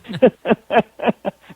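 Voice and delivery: real, rolling laughter; hearty laugh. Real, not acted. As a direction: crack up at your own story. Laughing at own eagerness.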